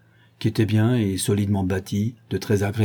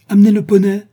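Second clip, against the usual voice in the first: high, loud imperious order; commanding; 12 semitones higher, 11 dB louder than usual. A narrator's reading. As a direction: call out a sharp command. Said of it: "commanda-t-elle". (ordre crié, impérieux)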